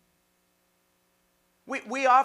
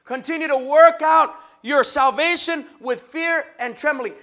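ordinary talk

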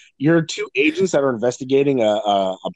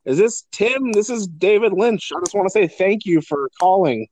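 David Lynch impression; 6 semitones higher than usual. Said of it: "you get a call like".

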